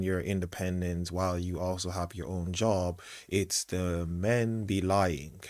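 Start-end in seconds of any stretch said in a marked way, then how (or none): 3.3-5.4 slow, stretched-out mock emphasis; mocking quote. Commentator mocking what the mother objects to, drawn-out. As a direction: drag out the quoted phrase pointedly.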